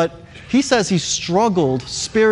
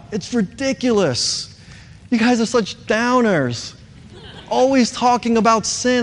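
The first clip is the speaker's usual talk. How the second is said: imitated newcomer's higher-pitched griping; mock complaining; 6 semitones higher than usual. Newcomer recalling her first doubts about the church, mock complaining.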